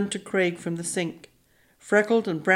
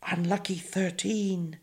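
slow, hushed, ominous warning; ominous whisper; 6 dB quieter than usual. A narrator's reading. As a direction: whisper it slowly like a bad omen. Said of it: "Fiona whispered ominously".

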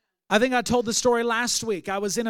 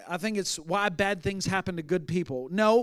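ordinary delivery

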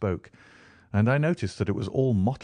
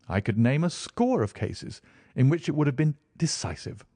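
raised, eager Holmes enthusing over crimes; brisk detective reeling off cases; Sherlock Holmes voice; 9 semitones higher, 3 dB louder than usual. Acted. Holmes lecturing Stamford on famous crimes, eager and raised.

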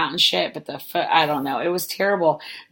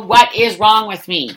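louder slower exasperation; 5 semitones higher, 11 dB louder, slower than usual. Real, not acted. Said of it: "repeated louder, slower".

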